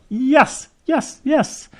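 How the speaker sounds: high, celebratory yeses; triumphant cheer. Retold or imitated. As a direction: cheer each yes like a win. Retold cheer at perfect pictures.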